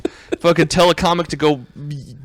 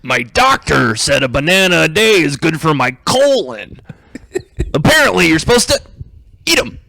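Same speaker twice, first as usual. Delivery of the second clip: Dwight York impression. Comic shows his Dwight York impression, lightly voiced stand-up. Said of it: "Dwight York".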